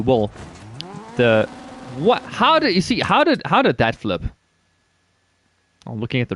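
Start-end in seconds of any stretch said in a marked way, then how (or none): 2.0-4.2 high, rising disbelief at the flip; how did that even happen; baffled outburst. Real, not acted. Gamer cries out in disbelief as his car flips.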